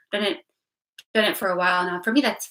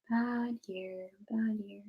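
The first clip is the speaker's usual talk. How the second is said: softer and softer, down to a breathless whisper; fading whisper; 8 dB quieter, much slower than usual. Real, not acted. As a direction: let bad year die away into a whisper. Repeating bad year, fading out.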